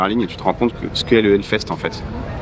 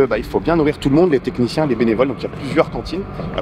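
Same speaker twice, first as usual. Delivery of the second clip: normal talking voice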